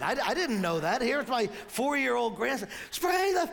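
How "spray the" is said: high mock yell of a worked-up child; imitated frustrated yell. Preacher imitating grandson yelling at a TV ant, high frustrated yell. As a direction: holler it like an exasperated little fan. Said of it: "Grayson yells out at the ant".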